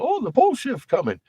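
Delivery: mock the doom warning like it's silly. Ridiculing a commentator's pole shift claim, sneering. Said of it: "Ben Davidson over there talking about".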